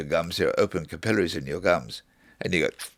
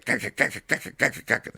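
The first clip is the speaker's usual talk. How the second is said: imitated gak call; 4 semitones higher than usual. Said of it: "and she goes".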